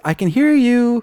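high parrot squawk. Acted. Parrot answering the captain in a high squawk. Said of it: "which the parrot says".

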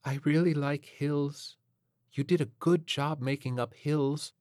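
put-on placid, spaced-out young crooner; sway through it sweetly and vaguely; professional waif. A narrator's reading. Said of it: "The professional waif".

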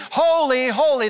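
loud, high, repeated acclamation; exultant worship cry. Acted. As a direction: cry it out over and over like heaven's worshippers. Resumes the heavenly worship cry.

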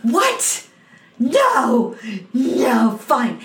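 high, indignant acted outburst; losing it. Leading player turns on the cast, losing it. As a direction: imitate a spiteful tantrum, pitch leaping up. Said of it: "the leading player loses his shit".

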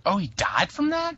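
high, incredulous question; sudden stunned disbelief; surprised realization. Retold or imitated. Retold realization.

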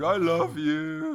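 put-on slow, loud, high drunken declaration; drunk guy. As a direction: drawl it like a street drunk. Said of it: "he sounds like a drunk guy walking down the street going".

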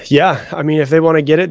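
usual speaking voice

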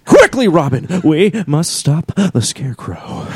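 dramatic hammy superhero voice with drawn-out pauses; Adam West. Acted. Gamer playing Arkham Knight as Batman, hammy declaiming. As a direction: declaim like an old TV Batman, stretching the words. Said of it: "doing Arkham Knight all as Adam West".

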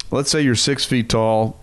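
ordinary talk, nothing marked

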